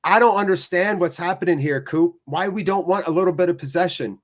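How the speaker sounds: ordinary delivery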